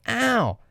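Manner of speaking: put-on high-pitched kid's "ow"; mock yelp of pain. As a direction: cry out as if just smacked. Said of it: "and then he'll go".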